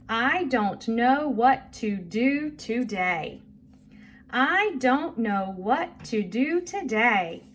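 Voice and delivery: slow, deliberate read-along; measured poem reading for young learners; reading a poem aloud. Shown as a demonstration. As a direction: read it slowly to a child, stressing the words. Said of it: "first I'm gonna read this to you".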